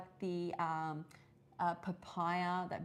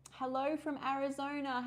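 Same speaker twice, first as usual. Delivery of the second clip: chirpy greeting; 7 semitones higher than usual. Real, not acted. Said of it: "reading a live comment aloud".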